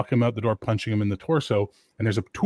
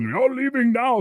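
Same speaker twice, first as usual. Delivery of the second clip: high, louder mock order; mimicked angry yell; 5 dB louder, slower than usual. Rico repeating the yell he overheard, mimicked yell. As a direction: echo a bouncer's yell in a high voice.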